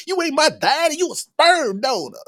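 high, mad girlish voice imitating the daughter. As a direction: squeal it like an angry teen girl. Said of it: "sounds like the female version of [name]".

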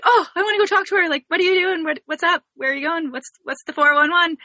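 high, quick burst of chatty questions, easing down; re-enacting an eager friend pouncing with questions; eager greeting. Student spotting her friend passing the dorm, eagerly chatty.

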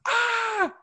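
high shrieking yell; mock yell. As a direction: let out a sudden shriek. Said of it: "yell violently".

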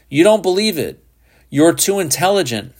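high, arguing insistence; insistent challenge. A narrator's reading. Heated argument over belief.

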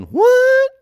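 very high squeaked what; shocked partner reacting to farting in bed. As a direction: yelp it in disbelief. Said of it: "you're like going".

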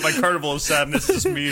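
ordinary talk

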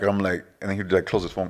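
ordinary talk

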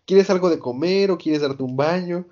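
lightly voiced fawning cook; doting Sanji. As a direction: greet her like an eager host. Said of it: "le dice".

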